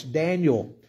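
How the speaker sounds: normal talking voice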